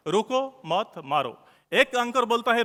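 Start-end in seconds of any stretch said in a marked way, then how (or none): 0.1-1.5 रुको, मत, मारो as three separate words; slow, with a gap after each of रुको, मत and मारो. Shown as a demonstration. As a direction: count out रुको / मत / मारो one at a time. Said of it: "तीन वर्ड है".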